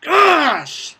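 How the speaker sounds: loud, high yelled gosh; frustrated shout. Real, not acted. Gamer yelling after dying in the match. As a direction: shout it out in exasperation. Dies in game.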